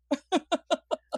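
high rising giggle. Real, not acted. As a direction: let out a giggle. Laughing at shared joke.

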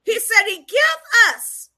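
high, forceful rebuke from the pulpit; fiery preaching. Real, not acted. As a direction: scold the congregation with full preaching force. Preaches against bashing people.

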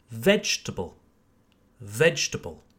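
"vegetable" as VEJ-tə-bəl, falling; no extra syllable. Shown as a demonstration. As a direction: model "vegetable" in three syllables. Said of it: "tendency to add an extra syllable".

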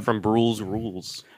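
mock title. Joke title said slowly.

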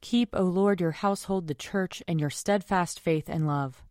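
ordinary delivery

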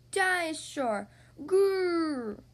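put-on playful toddler dinosaur roar; dinosaur growl. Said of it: "growls George".

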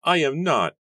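put-on high, loud, peevish child; peevish cry. A narrator's reading. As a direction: protest like a cross little boy. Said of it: "Ezra cried peevishly".

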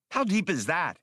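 a high urgent squeak of alarm; urgently. Acted. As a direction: demand it in a rising fright. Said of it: "Spray asked urgently".

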